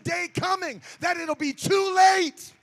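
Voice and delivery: loud, rising sung preaching; full force preaching. Acted. Preacher shouting holiness at the congregation, loud and sung. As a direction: whoop it up to the congregation.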